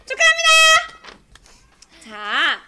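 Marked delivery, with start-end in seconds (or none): 0.0-1.1 drawn-out high cheer; excited congratulations. Real, not acted. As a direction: cheer the winner loudly. Prize winner announced.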